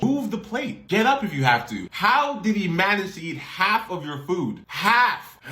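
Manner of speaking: high-pitched incredulous outburst; outraged disbelief. Real, not acted. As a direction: rise high in disbelief at the story. Outraged reaction to story.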